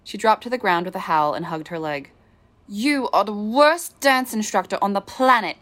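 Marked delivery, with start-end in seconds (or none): 2.7-5.6 higher, louder, slow furious snarl. A narrator's reading. Injured student snarls at her cruel dance instructor. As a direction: spit it out at him in pain and rage. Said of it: "she snarled".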